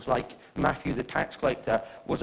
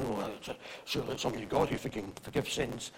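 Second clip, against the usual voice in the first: muttering; 7 dB quieter than usual. Acted. Religious people objecting among themselves as Jesus forgives, muttering. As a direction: mutter it quickly under your breath. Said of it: "religious people started to mutter".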